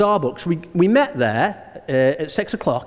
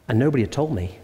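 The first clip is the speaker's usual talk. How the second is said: ordinary talk, nothing marked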